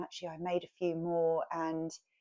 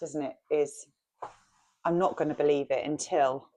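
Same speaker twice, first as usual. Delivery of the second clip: usual speaking voice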